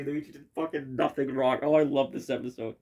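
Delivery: normal talking voice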